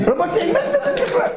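a high, laughing, outraged cry; indignant squeal. Acted. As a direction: squeak out the absurd protest. Character's indignant punchline.

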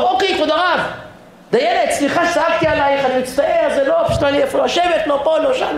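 high-pitched, run-on apologizing; mock apology. Passenger to flight attendant, high hammed-up apology. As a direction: imitate a chastened passenger pleading excuses. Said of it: "אתה מבקש סליחה מהדיילת".

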